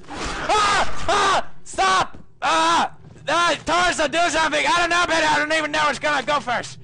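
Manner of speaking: loud, high frantic shrieks and pleas; real terrified yelling; panicked screaming. Real, not acted. Screaming panic in horror game.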